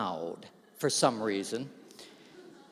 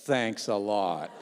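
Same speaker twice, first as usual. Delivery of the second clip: lower, slow and dry, a mock grumble; grudging sarcasm from the next reader; sarcastic thanks; 7 semitones lower, slower than usual. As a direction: poet muttering a wry, lower thanks. Poet pats him, sarcastic.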